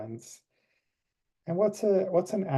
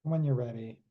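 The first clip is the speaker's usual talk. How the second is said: guided meditation. Acted. Leads closing meditation.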